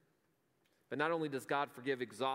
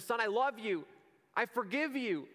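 higher, louder joyful welcome; joyful father; 9 semitones higher, 5 dB louder than usual. Acted. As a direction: pour out a father's joy. Father embracing returning son.

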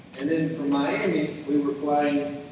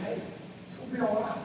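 very soft, soothing voice of God; gentle reassurance; 5 semitones higher, 12 dB quieter than usual. Retold or imitated. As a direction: reassure softly, almost under the breath. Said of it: "God is saying".

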